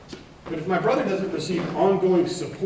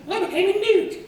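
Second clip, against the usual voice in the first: very high, loud falsetto outburst; Monty Python character; 4 dB louder than usual. Acted. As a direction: squeak it like a comic sketch peasant. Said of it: "I will drop Monty Python jokes".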